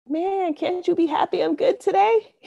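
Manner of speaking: squeaky high incredulous complaint; exasperated protest. Retold or imitated. To her boss, a high exasperated "can't you be happy". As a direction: whine it up high, half-laughing.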